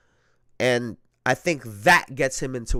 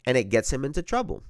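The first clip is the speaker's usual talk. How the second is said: ordinary talk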